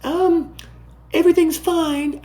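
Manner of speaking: high, airy mock calm; high-pitched mock "everything's fine"; mock reassuring Powell. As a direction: play him brushing it off in a falsetto. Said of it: "the market, I guess, expected him to say".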